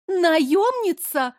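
high, slow, stunned question; shocked gasp. A narrator's reading. Blonde lady-in-waiting recognises the mercenary, shocked high gasp. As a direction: gasp the word out in disbelief. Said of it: "потрясённо ахнула".

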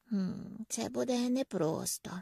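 soft, hesitant sigh of doubt. A narrator's reading. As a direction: let it out softly, like a sigh. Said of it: "зітхнув Меллі".

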